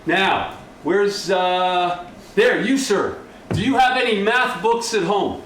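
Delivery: ordinary talk, nothing marked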